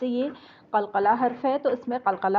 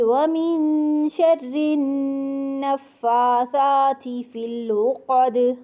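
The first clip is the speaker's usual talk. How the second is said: slow melodic tajweed chant of कुल अऊज़ु बिरब्बिल फलक; Quran recitation; 2 semitones higher, much slower than usual. Shown as a demonstration.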